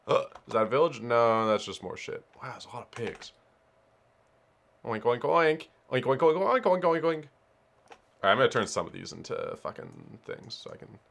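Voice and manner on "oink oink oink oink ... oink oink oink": higher-pitched run of oinks; pig imitation. Player sees lots of pigs, playful oinking imitation. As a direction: mimic a pig oinking over and over.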